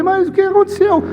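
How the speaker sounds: very high, startled phone reaction; acted high-pitched alarm; alarmed question. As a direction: demand to know what happened. Retold alarmed early phone call.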